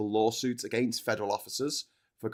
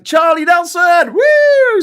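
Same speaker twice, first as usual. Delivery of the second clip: loud high cheer for the donor; excited shout-out; 14 dB louder, much slower than usual. Real, not acted. Host cheering a super chat donor, loud whoop. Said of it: "reading out a super chat donor".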